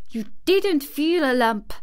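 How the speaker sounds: high, forceful denial; heated child's retort; fierce contradiction. A narrator's reading. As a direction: flatly refuse it, fiercely. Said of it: "contradicted Mary fiercely".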